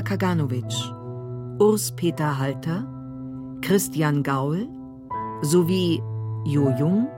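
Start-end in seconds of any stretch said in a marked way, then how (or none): none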